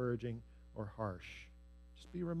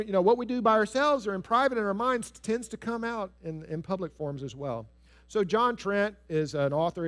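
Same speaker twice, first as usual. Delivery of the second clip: usual speaking voice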